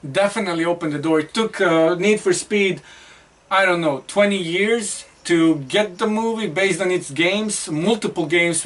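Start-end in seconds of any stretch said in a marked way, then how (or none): none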